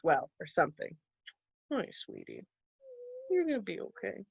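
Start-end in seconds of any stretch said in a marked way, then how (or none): 1.7-4.2 much softer, tender reassurance; soft comfort. Real, not acted. Comforting sad toddler.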